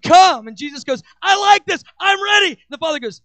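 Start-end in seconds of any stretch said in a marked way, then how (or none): 0.0-0.5 loud, high single plea of come; crying out. Acted. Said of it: "They're crying out". 1.2-2.5 high, loud excited I like this; Jesus shouting eagerly to the Father. Acted.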